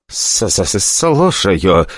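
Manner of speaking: frightened stutter on the first sound; scared director picking up the phone; stammering. Acted. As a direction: stutter out a nervous answer. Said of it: "заикаясь".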